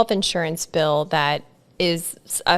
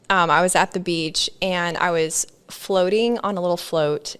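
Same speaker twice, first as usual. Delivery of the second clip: usual speaking voice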